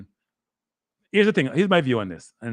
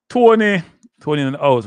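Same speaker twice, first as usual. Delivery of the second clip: excited call out; 5 semitones higher, 10 dB louder than usual. Real, not acted. Greeting viewer Tony joining chat.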